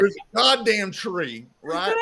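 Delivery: exasperated insistence. Real, not acted. Defending the cover art to friends, exasperated insistence. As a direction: hammer the point home.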